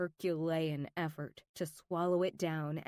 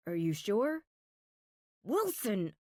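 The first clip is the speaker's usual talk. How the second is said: spat back. Acted. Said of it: "I all but spit back".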